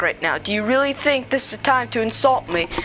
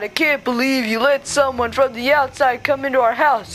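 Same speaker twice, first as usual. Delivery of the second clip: usual speaking voice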